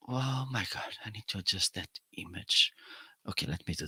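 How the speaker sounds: whispered to himself; quick whisper under breath. Real, not acted. Muttering to himself while adjusting.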